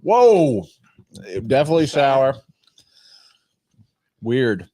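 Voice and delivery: chewing while talking; talking through a mouthful of candy; mouth full. Real, not acted. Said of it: "Sorry for the chewing, folks".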